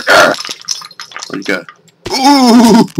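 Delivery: ordinary talk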